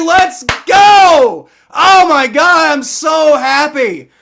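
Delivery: normal talking voice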